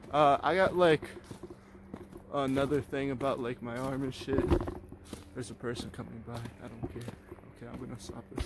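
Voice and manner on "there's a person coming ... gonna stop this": hushed. Real, not acted. Passerby comes, he hushes.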